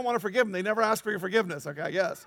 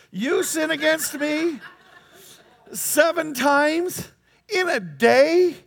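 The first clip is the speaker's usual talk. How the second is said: louder, drawn-out outraged question; indignant disbelief; 5 semitones higher, 8 dB louder, much slower than usual. Acted. Preacher voicing a fed-up listener, outraged and louder. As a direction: stretch it out in mock outrage.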